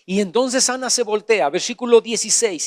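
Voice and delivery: usual speaking voice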